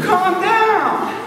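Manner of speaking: high-pitched mock "calm down" from a kid; retold kid's retort. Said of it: "He said".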